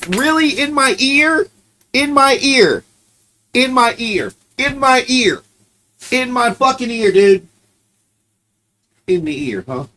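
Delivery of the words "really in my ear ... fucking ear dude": higher, incredulous complaint; you can't believe the dog did that; complaining to the dog that barked in his ear, higher and indignant; indignant disbelief. Real, not acted.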